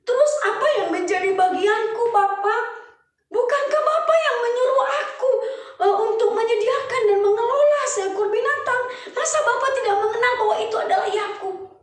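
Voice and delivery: a weeping son accusing his father; crying Esau. Acted. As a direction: sob out the complaint to your father. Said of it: "Dia menangis lagi".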